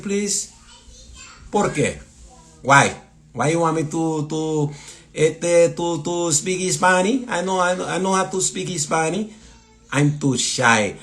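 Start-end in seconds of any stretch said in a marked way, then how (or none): 1.5-11.0 higher-pitched mock Spanish accent. Host playing a shy Spanish speaker on a viewer's request, Spanish accent. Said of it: "I did the Spanish accent for you".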